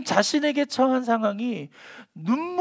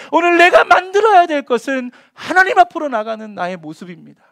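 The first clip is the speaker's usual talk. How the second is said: loud, higher impassioned sermon; fiery preaching; 7 semitones higher, 10 dB louder than usual. Acted. Pastor urging the congregation, loud and impassioned. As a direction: preach it at full force.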